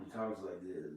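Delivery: low, soft, deadpan imitation of the comedian; Norm Macdonald impression. Telling his friend how Norm Macdonald talks, low and soft. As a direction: drop low and quiet like Norm. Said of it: "Norm Macdonald".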